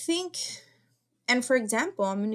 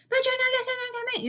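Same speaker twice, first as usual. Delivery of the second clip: put-on much higher child's voice, frustrated; irritated little daughter; 5 dB louder than usual. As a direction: whine it like an upset young girl. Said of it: "she's like".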